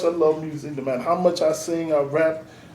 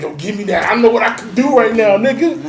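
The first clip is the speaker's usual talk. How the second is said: loud, high, eager outburst; itching to perform; 5 semitones higher, 10 dB louder than usual. Acted. Artist inside him itching for the mic, eager outburst. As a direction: demand the mic, voice climbing to a near shout. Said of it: "The artist in me be itching".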